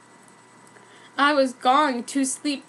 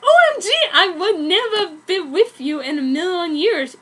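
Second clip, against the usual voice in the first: louder, breaking into laughter; laughing through it; 5 semitones higher, 6 dB louder than usual. Real, not acted. Reader can't hold it together rejecting Jasper, laughing through the line. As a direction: read it while cracking up.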